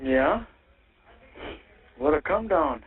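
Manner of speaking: ordinary talk, nothing marked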